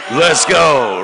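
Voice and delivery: loud, high "Let's go"; rousing shout. Shown as a demonstration. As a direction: fire up the crowd. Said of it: "And then we simply say what?".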